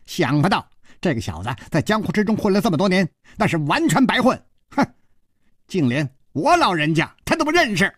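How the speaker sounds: put-on high, huffy old eccentric; grumbling dwarf. A narrator's reading. Dwarf muttering about Hu Tiehua, grumbling in a high voice. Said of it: "喃喃地说".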